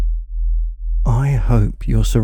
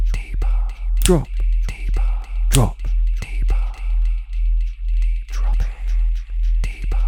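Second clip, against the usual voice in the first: whispered commands. Acted. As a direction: breathe the words like a spell. Whispered layered trance commands.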